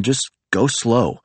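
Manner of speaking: said slowly and steadily; driving instruction said slowly; slow, calming. Acted. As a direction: ease him down. Calming panicked driver.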